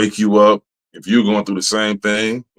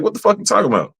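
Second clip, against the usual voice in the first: high incredulous retort; 10 semitones higher, faster than usual. Retold or imitated. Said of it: "I'm like".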